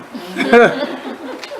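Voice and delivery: airy unvoiced chuckle; breathy mock laugh. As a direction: let a soft breathy laugh slip out. Acted laugh after quote.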